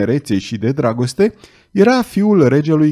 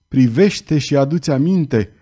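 usual speaking voice

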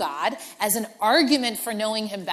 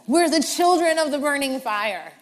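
higher, louder movie-trailer proclamation; epic fantasy title; 6 semitones higher, 8 dB louder than usual. Shown as a demonstration. Preacher playing the phrase as an epic fantasy name. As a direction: announce it like a fantasy saga's title. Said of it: "sounds a little bit like a fantasy series".

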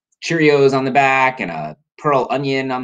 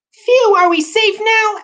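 put-on very high, loud little-kid voice; tiny Toast; 6 dB louder, slower than usual. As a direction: squeak it slowly like a tiny child.

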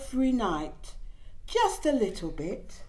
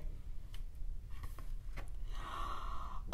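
gasp; 13 dB quieter than usual. Acted. Reading a baby book aloud.

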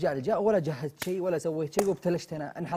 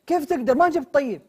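higher, louder disbelief; exasperated; 9 semitones higher, 8 dB louder than usual. Acted. Yasser shouting back in disbelief at Mitab. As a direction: throw his words back at him. Arguing over the dessert.

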